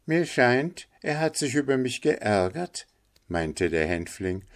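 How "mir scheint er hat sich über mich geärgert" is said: high-pitched little bird voice; concerned linnet. Acted. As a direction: confide your impression in a high voice. Figure of the linnet. (Hänfling mit hoher Vogelstimme)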